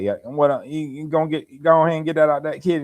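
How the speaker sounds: high-pitched mocking country relatives' accent. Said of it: "mock them".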